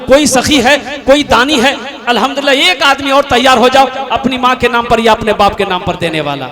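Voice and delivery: loud fervent appeal for donors; preaching donation call. Acted. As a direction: call out for a giver, loud and rousing. Calls for donors at full force. (जोशीली Chanda appeal)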